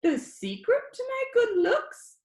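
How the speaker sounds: high, squeaky, cocky boast; high squeaky boy. Acted. Danny jokes to his viewers in a high squeaky voice. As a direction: brag like a cheeky kid showing off. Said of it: "His voice was high and squeaky".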